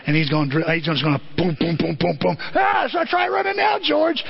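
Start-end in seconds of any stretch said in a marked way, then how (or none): 2.5-4.2 mock threatening shout. Preacher voicing Bert, high mock shout. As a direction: shout the taunt at George.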